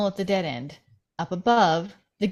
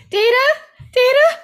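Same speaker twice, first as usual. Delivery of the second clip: much higher, loud frantic calling; panicked shout; 10 dB louder than usual. Acted. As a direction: yell the name in panic down the hall. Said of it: "She shouts in the hall, panicked".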